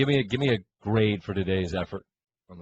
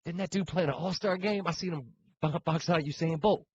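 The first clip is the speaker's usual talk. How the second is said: higher mock guy voice; put-on guy voice; 7 semitones higher, 3 dB quieter than usual. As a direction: tease like a pickup player.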